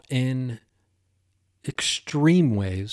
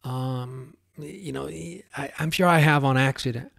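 ordinary talk

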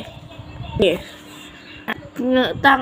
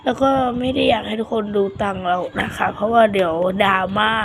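ordinary delivery

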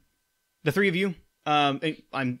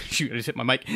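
usual speaking voice

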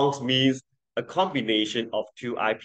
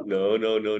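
usual speaking voice

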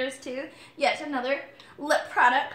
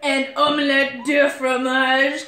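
slow mock French, laughing; hammy French phrase through laughter; French accent; 5 dB louder, slower than usual. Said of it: "the only thing that people know how to say in French".

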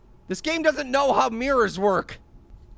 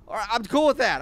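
ordinary talk, nothing marked

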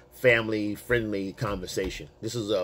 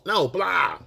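emphatic correction; 7 semitones higher than usual. Retold or imitated. She corrects his mishearing.

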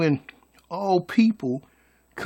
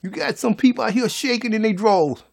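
ordinary delivery

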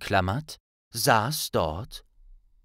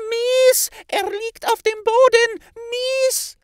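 very high, loud squeaky shriek; tiny servant squealing that his master fell; squeaky house-elf; 7 dB louder, slower than usual. A narrator's reading. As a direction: shriek it like a panicking little elf. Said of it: "quietschende Stimme".

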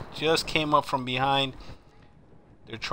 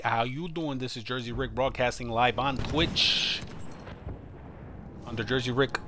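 ordinary delivery

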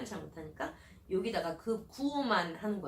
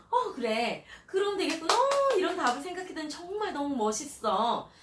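much higher and louder, gushing; excited praise; 9 semitones higher, 9 dB louder than usual. Pretend. Mother praising her child's own answer, excited gushing. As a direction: cheer the kid's idea like it's brilliant.

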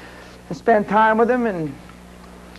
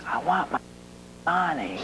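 much softer, flat demand from a mob boss; quiet menace; 10 dB quieter than usual. Retold or imitated. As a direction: lean in and threaten softly. Mob boss leaning across table.

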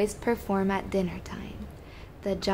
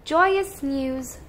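a touch of knightly cheer, higher pitched; 5 semitones higher, 3 dB louder than usual. A narrator's reading. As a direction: lightly cheer it as a gallant knight. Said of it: "Sir Bravelot cheered".

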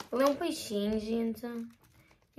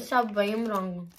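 speaking with food at lips; mid-bite mumbling; biting a biscuit. Real, not acted. Seen 0: Bear-shaped biscuit held at lips, biting it from 0.9s onward.

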